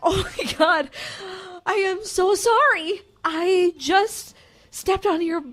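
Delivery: high, slow, near-tearful gushing; flustered fan apology, high and drawn out; mortified apology. Retold or imitated. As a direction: squeal sorry at the star. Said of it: "I said".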